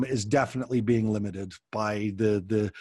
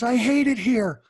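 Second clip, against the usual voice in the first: put-on much higher and louder, a furious kid's yell; upset child; 11 semitones higher, 6 dB louder than usual. Shown as a demonstration. Daughter yelling at her parents during a hard talk, angry shout.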